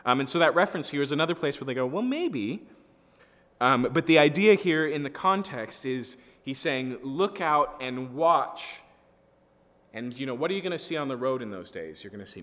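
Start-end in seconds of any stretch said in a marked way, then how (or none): 1.8-2.7 high, sing-out note of doubt; skeptical, noncommittal lilt; doubtful maybe. Acted. Doubting reply to the commentators. 7.0-8.8 slow, raised call to keep watch; higher, louder, deliberate lookout's call; urgent warning. Acted.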